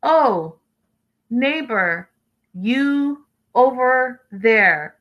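exaggeratedly slow, separated words; mock broken English. Talking down to the neighbor next door, mock slow broken English. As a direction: stretch each word as if the listener can't understand. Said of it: "wouldn't talk like that with your neighbor".

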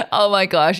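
normal talking voice

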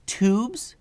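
high, rising fairy voice; little fairy. Acted. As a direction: call a friend's name as a question, pitched high. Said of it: "said the fairy".

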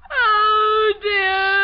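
tearful sobbing cry. Acted. Daughter breaking down under questioning, crying. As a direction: break down into tears.

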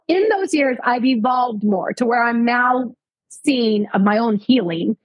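normal talking voice

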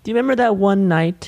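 high, put-on celebrity voice; Eddie Murphy voice. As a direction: pitch it way up as Eddie. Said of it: "Why do you sound like this?".

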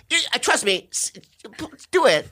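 put-on high, eager kid voice; high giddy kid urging a man; excited kid. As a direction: sell the fun like a giddy child.

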